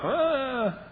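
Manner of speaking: high, put-upon mock whine; whiny youngest child. Youngest child complaining as sisters run off, high whine. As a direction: play the put-upon little kid. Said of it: "sisters go running off".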